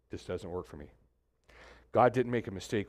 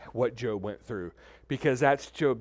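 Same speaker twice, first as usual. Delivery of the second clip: ordinary delivery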